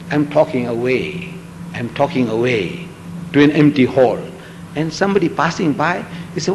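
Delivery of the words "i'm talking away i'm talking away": lower, softer and slower, almost under his breath; muttering along to no one; murmured to himself. Pretend. Lecturer alone in an empty hall, lower, softer, slower murmur.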